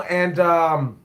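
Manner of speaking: ordinary delivery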